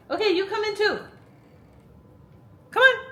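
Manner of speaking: high, loud call to a dog. Real, not acted. Letting the dog in mid-video, a high loud call. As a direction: coax the puppy in from outside.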